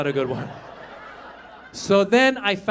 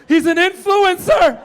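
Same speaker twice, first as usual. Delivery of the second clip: put-on excited child; 8 semitones higher, 7 dB louder than usual. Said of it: "son answering".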